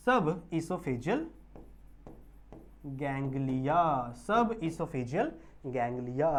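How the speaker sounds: slow, spaced dictation of the name; teacher asking the class to listen carefully. Shown as a demonstration. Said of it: "ध्यान से सुनेंगे".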